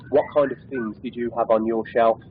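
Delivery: usual speaking voice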